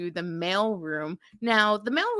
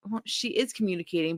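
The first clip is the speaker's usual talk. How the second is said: ordinary talk, nothing marked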